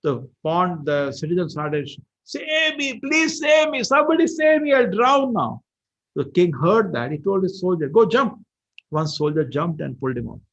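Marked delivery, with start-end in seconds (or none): 2.2-5.6 high, loud acted plea for rescue; cry for help. Drowning citizen begging anyone nearby, acted cry for help. 7.9-8.4 high, loud acted command; shouted order. King sending soldier into the pond, shouted order.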